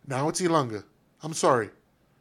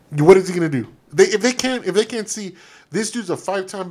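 frustrated outburst; 4 semitones higher, 6 dB louder than usual. Real, not acted. Angry rant at players.

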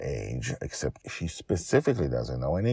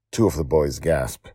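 plain reading voice

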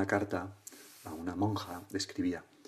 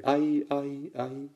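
higher repeated cry, fading away; mountain echo; 5 semitones higher, much slower than usual. Acted. Mountain returns the boy's cry, fading echo voice. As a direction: imitate an echo dying away. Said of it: "el eco de la montaña le devuelve".

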